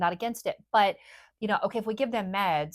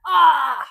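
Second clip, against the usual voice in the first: loud, high open-throated "ah"; demonstrated release yell; 9 semitones higher, 13 dB louder than usual. Shown as a demonstration. Therapist showing what boys sometimes need, venting yell. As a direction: let out a sudden big venting yell.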